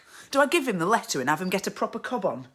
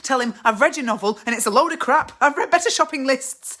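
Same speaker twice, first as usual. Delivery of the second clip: higher, loud, building scorn; blunt telling-off; 6 semitones higher, 7 dB louder than usual. Acted. Wife rehearsing telling husband his novel is crap, scornful. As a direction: let him have it, louder as it goes. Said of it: "One day I'll tell him".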